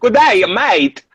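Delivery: mock Australian accent. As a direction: stretch out a hammy Australian hello. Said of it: "Australian Christopher Walken".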